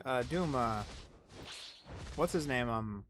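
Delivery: ordinary talk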